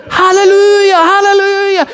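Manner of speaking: high, loud joyous shout; shouting hallelujah. Retold or imitated. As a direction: shout it out like an overjoyed mother. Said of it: "she is shouting".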